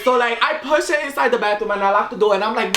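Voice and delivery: ordinary delivery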